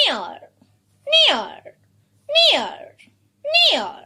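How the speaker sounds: "Near" joined in one falling syllable; blended word. Shown as a demonstration. Teacher joins the sounds for children, blended "Near". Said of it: "join all the sounds".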